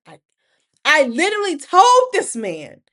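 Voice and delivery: normal talking voice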